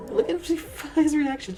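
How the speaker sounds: high, slow and laughing. Real, not acted. Watching Fi's reaction clip, laughing high and slow.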